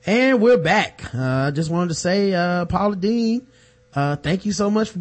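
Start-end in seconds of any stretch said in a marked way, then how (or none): 0.1-1.1 mock TV host. Mock show returns from commercial.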